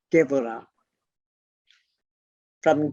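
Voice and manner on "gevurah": "Geburah" said as "Gevurah", lower and measured; B pronounced as V. Shown as a demonstration. Teacher modelling the word for students, "Geburah" with b as v. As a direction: say "Geburah" with a v sound for the b. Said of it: "a B in the middle of the word is pronounced V".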